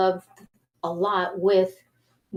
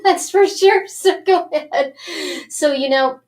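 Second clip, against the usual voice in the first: laughing; 7 semitones higher, 6 dB louder than usual. Real, not acted. Laughs about cracking up.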